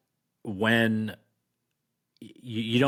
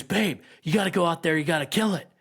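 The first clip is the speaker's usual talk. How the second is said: higher, mock frantic scream; imitating a scream; 6 semitones higher, faster than usual. Wife screaming at husband to kill it, mock frantic. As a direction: press him to go out, hammed-up panic.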